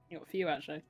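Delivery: ordinary delivery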